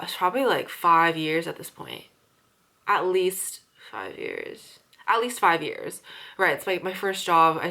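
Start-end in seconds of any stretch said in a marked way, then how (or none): 2.9-4.8 each word drawn out slowly; slow, weighted repeat; slow emphatic. Real, not acted. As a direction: stretch it out to stress the length. Stressing how long she's worked.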